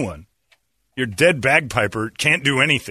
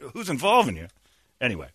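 ordinary talk

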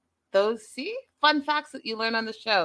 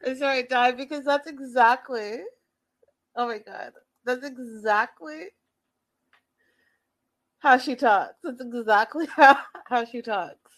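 helpless with laughter between phrases; trying not to laugh; 3 semitones higher than usual. Real, not acted. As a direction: get it out while cracking up. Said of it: "let me stop laughing".

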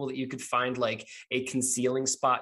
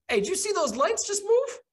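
much higher, alarmed voice; a guard jolted by moving lights; startled guard; 4 dB louder than usual. Acted. Game master voicing a guard who spots the lights, startled and high. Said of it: "another voice that goes".

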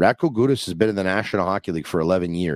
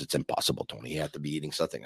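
hushed wife; 6 semitones lower, 11 dB quieter, faster than usual. Retold or imitated. Wife's doubting reply retold.

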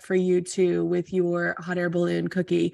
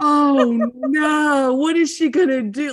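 loud, high panicked wail; mock freak-out; 4 semitones higher, 8 dB louder, slower than usual. Watch party freaking out over a baking mishap, loud mock panic. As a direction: cry out in exaggerated alarm. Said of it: "we were freaking out".

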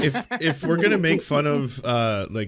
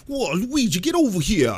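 mock Brooklyn Mario, pitched up; higher-voiced Brooklyn send-up; half Brooklyn accent; 6 semitones higher than usual. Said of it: "doing a weird half Chris Pratt, half Brooklyn accent".

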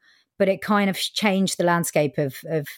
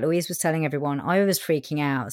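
ordinary talk, nothing marked

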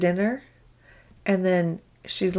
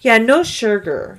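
put-on slow, louder, higher kid voice; child's reply; 4 semitones higher, 6 dB louder, slower than usual. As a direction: imitate a kid spelling out the rule. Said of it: "she'll be like".

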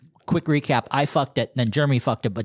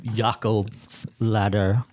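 drawn-out title; 3 semitones lower, much slower than usual. Acted.

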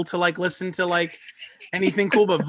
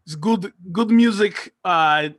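put-on Russian accent; 3 semitones higher, 4 dB louder than usual. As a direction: play a Russian goalie, hammy. Mock Russian goalie quote.

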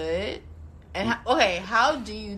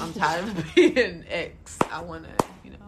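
giggling through the words; 9 dB louder, faster than usual. Real, not acted. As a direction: laugh while you say it. Tipsy guest laughing mid-answer.

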